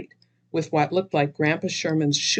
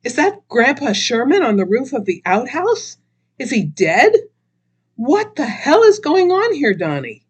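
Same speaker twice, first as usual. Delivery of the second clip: horrified; 9 semitones higher, 7 dB louder than usual. Acted. Horrified at the corpse.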